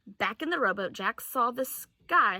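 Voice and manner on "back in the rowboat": usual speaking voice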